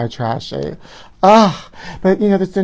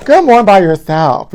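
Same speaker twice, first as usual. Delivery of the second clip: loud slow retort; 5 semitones higher, 7 dB louder, slower than usual. Real, not acted. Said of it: "repeats her retort louder".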